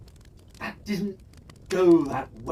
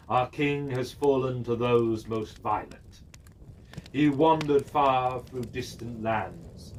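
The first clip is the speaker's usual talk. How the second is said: solemn proclamation; 5 semitones lower than usual. Acted. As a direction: read it like a mournful court herald. Reads the royal court statement.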